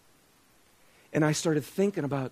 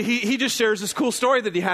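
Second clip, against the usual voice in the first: ordinary delivery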